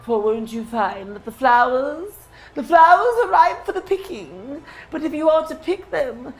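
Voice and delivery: high, sly creature taunt; teasing satyr voice. Acted. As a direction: tease them playfully as the villain.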